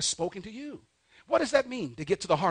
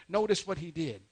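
normal talking voice

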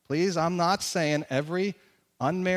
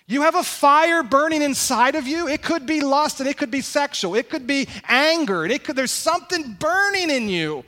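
raised, higher, louder sermon push; fiery preaching; 6 semitones higher, 4 dB louder than usual. Acted. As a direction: preach it hot, lifting the room. Preaching with rising intensity.

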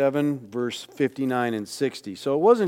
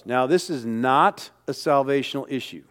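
ordinary delivery

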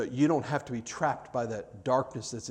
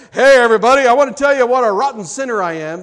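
hammed-up announcement, much louder and higher; mock loud confession; 9 semitones higher, 15 dB louder, faster than usual. Preacher mocking a public confession to the congregation, loud and hammed-up. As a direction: shout it to the whole livestream like a mock confession.

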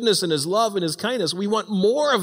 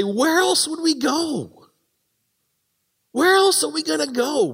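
Peter's plea; 9 semitones higher than usual. Retold or imitated. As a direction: ask it like there's nowhere else to turn. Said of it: "we say".

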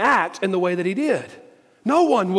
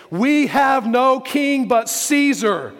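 slow, higher, weighty declaration; emphatic proclamation; 6 semitones higher, 5 dB louder, much slower than usual. Acted. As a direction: proclaim it word by word to a crowd. Preacher dramatically quotes the chief priests.

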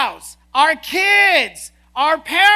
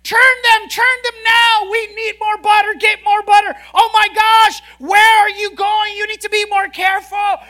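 loud, high, panicky husband shouting orders; hammed-up yell of a fussing husband; mock frantic yelling; 5 semitones higher, 6 dB louder than usual. Husband yelling cooking orders at his wife, mock frantic shout. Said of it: "he begins to scream at the top of his voice".

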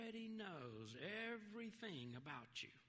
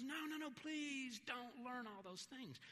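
high-pitched imploring friend; retold pleading; 8 semitones higher than usual. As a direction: beg them earnestly, imitating a friend.

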